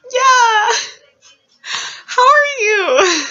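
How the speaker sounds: very high, loud strained greeting; hammed-up awkward squeak; forced awkward cheer. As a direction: greet an ex while screaming inside. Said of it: "trying to be the less awkward I can because I'm screaming inside".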